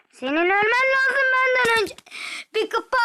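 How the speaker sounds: high, loud urgent plea; frustrated yell. Real, not acted. Shouting at the enemy player to die first. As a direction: yell at the screen to make him die. Yelling at opponent in game.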